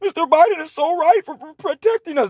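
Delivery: very high, sobbing whine; mock a hysterical liberal in tears; mocking crybaby voice. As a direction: falsetto boo-hoo impression, crying the words. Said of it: "That's what you sound like".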